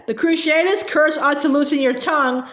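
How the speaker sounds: plain reading voice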